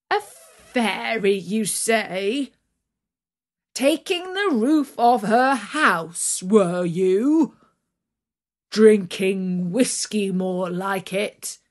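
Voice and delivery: loud mocking farm master, scornful; jeering master. Farm master jeers at his worker, loud and scornful. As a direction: sneer it down at him like a boss. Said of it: "the master jeered at him".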